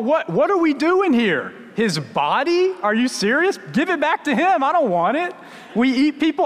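high-pitched comic disbelief; mock alarm. Child's thoughts on hearing about eating a body, comically incredulous. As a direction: blurt it out as an appalled kid, voice jumping up.

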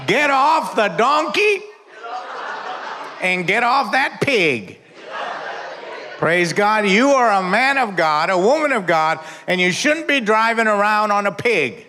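fiery preaching. Acted. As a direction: preach it hard at the congregation. Preaching at full force.

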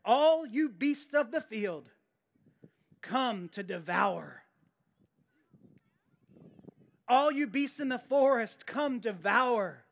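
slow, weighty reading of scripture; slow, ominous scripture reading; solemn scripture reading. Acted. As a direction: read the prophecy slowly, letting each line land. Reading Isaiah aloud with gravity.

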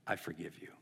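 very soft, low and sincere; gentle forgiveness. Retold or imitated. Coach forgiving the owner face to face, hushed and tender. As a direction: grant pardon almost under your breath. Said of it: "He looks her in the eye and he's like".

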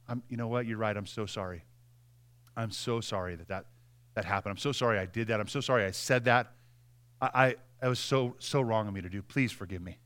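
soft, low and pleading; humble apology. Acted. Acting out the gentle reply to someone who came to fight, soft and pleading. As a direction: plead for forgiveness in a hushed voice.

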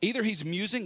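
mock them with fake encouragement. Elijah mocking the prophets, high and sarcastic. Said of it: "Elijah mocked them".

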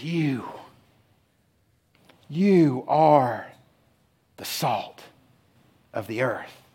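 low, hushed words with long pauses; solemn, deliberate quote. Retold or imitated. Jesus looking out over his gathered disciples, solemn and deliberate. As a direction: let each phrase settle in the silence.

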